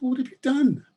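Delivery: put-on shocked children. A narrator's reading.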